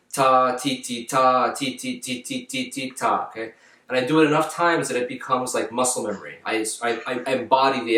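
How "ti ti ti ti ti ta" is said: normal talking voice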